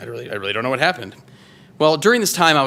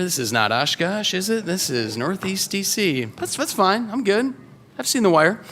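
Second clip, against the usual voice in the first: higher, talking himself into feeling safe; anxious inner voice, pitched up; nervous self-reassurance; 8 semitones higher than usual. Retold or imitated. Newcomer reassuring himself on a DC street, nervously higher.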